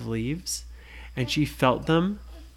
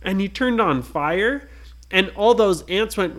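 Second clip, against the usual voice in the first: ordinary delivery, nothing marked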